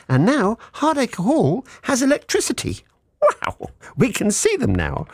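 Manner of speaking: high-pitched, playful wonder; mock amazement. Mock amazement at electricity arriving.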